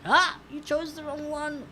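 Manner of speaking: high, drawn-out mock gotcha; gleeful gotcha. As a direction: pounce on the mistake, slowly. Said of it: "then I would have been like".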